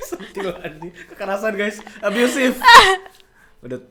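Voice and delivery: high loud laughter over mock sadness; laughing through pain. Real, not acted. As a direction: joke about the hurt, cracking up. Joking about abuse while laughing.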